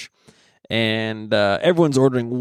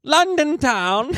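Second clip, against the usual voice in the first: put-on higher, slow, jolly Old England voice; mock English accent; 12 semitones higher, slower than usual. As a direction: ham up a posh London twang. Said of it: "Not jolly old England".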